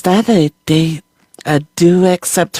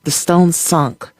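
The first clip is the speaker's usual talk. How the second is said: ordinary delivery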